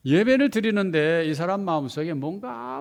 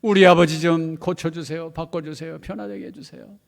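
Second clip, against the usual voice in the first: tearful prayer; 4 semitones higher, 9 dB louder than usual. Retold or imitated. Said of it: "막 울면서 기도합니다".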